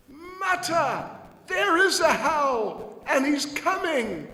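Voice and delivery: put-on high, loud, slow child's cry; a small boy insisting the monster is coming; little Krishna shouting to his mother; insistent little Krishna.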